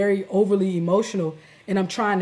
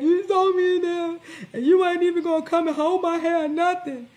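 mocking upset girlfriend; 10 semitones higher than usual. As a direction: mimic her crying complaint, pitched way up. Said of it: "She's like".

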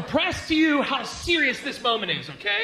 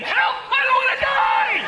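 high, loud panicked cry for help; hammed-up terrified shriek; screaming; 7 dB louder than usual. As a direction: scream as if the sharks are on you. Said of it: "I'm screaming my native".

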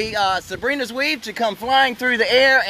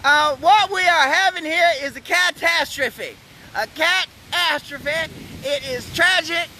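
mock news anchor; 6 semitones higher than usual. Mock news anchor catastrophe report.

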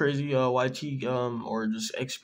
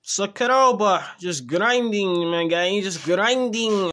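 slow, high hyped-up praise; hype shout; 8 semitones higher, 7 dB louder, slower than usual. Real, not acted. Hyping a friend.

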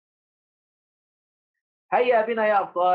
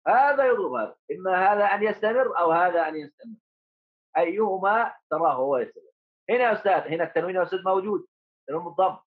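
normal talking voice

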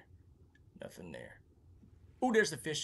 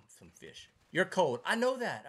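usual speaking voice

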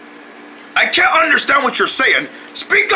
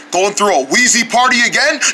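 ordinary delivery

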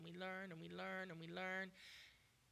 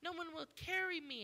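higher, louder self-pitying lament; the lame man's complaint; 6 semitones higher, 4 dB louder than usual. Retold or imitated. Man at the pool complains nobody helps him, pitiful. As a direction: moan it like the man who waited years. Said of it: "this man laying here for thirty-eight years".

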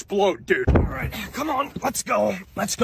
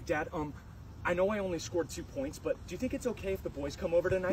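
soft, hesitant asking; meek request; 4 semitones lower, 10 dB quieter than usual. Acted. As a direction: tiptoe up to asking Dad. Son meekly asks dad permission.

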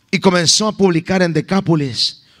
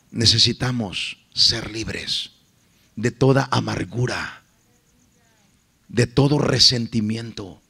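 lower, softer and slow preaching; soft closing appeal; 9 semitones lower, 6 dB quieter than usual. Acted. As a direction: draw them in gently at the end.

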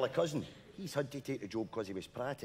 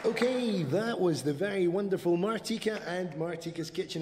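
bright, upbeat hospital-radio presenter; cheesy local radio DJ voice; radio DJ patter; 6 semitones higher, 4 dB louder than usual. Acted. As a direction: announce it to the retirement home listeners.

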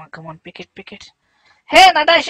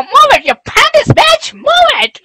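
high, loud Move it!; frantic yell; 11 semitones higher, 4 dB louder than usual. Acted. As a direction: shout Move it! in panic. Yells at phone.

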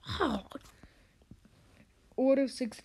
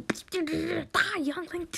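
high-pitched battle cry; hammed-up killer's yell; mock attack cry; 7 semitones higher, much slower than usual. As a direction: cry it out high while striking. Acted attack on a youngling.

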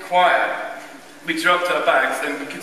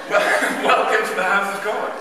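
higher, laughing pious greeting; laughing mock welcome; 5 semitones higher, 3 dB louder than usual. Choirboy mocking the smug congregation, laughing. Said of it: "looking and going".

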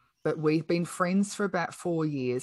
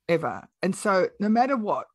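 ordinary delivery

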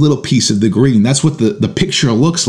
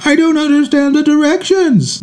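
put-on much higher kid voice, a little whiny. Parent mimicking his son's art project complaint in a high kid voice. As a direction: play the helpless eleven-year-old. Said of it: "and he says".

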